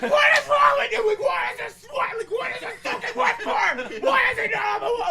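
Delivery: very high, loud outraged shouting; furious screaming. Retold or imitated. Friend scolding owner of ruined print, furious screaming. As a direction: re-enact screaming your head off. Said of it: "Like, I was furious".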